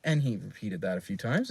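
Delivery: ordinary delivery, nothing marked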